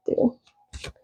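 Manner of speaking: whisper. Real, not acted. Whispered aside to dog.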